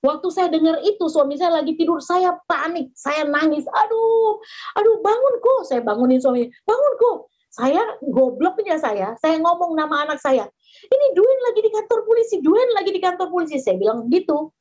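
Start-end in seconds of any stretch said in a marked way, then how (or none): 3.7-5.6 high, urgent panicked cry; panicked pleading. Retold or imitated. Wife shaking her husband awake in panic. 6.6-7.2 high, urgent panicked cry; panicked pleading. Retold or imitated. Wife shaking her husband awake in panic. 10.9-13.6 high, rushed alarm easing off; panicked mother. Retold or imitated. Mother telling husband their son is held.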